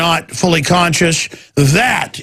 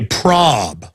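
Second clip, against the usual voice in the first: mock trendy slang; 5 semitones lower than usual. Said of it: "Now they say".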